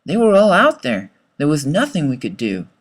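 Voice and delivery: ordinary delivery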